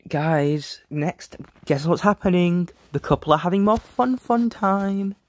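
high, playful tease; mock-giddy reveal; excited. Real, not acted. Teasing next scene to viewers.

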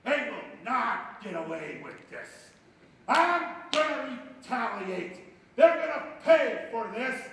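slow, building vow that ends high and loud; vowing revenge. Acted. Preacher voicing Samson swearing revenge on the Philistines, slow building fury. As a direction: start grim and climb to a roar. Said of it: "He says".

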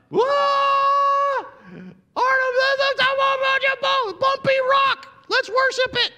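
very high, loud, goofy thrilled shout; mock gullible excitement. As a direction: ham up a dazzled crowd gushing over a rock. Mocking crowd excited over rock.

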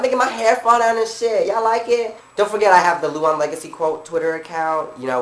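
normal talking voice

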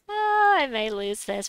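excited. Real, not acted. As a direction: revel in the risk. Excited risky play.

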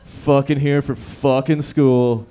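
loud, slow, sarcastic cheer; mock student enthusiasm. Comic mocking returning students, loud slow fake excitement. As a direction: drag it out like a bored student faking hype. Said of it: "Everyone's like".

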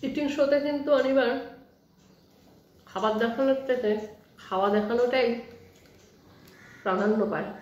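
chewing between words; speaking around food; mouth full, chewing. Real, not acted. Seen 0: Food put in mouth at -2; jaw moves mid-speech, lips pursed.

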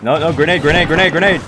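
loud urgent shout of grenade; grenade warning yell. Real, not acted.

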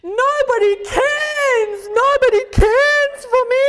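high, loud, stretched-out self-pity; mock wailing. Shown as a demonstration. Teacher acts out memory hook for Cairns, mock wailing. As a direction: wail it slowly, hammed-up misery. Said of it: "You've got to be saying it".

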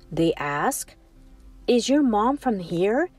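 plain reading voice

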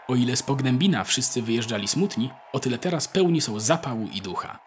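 usual speaking voice

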